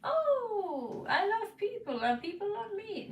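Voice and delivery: high, bright mock-cheerful voice; mock cheery voice. Tarot reader mocking the client's false cheer, high and sing-song. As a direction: parody a carefree social butterfly. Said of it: "It's basically, you know".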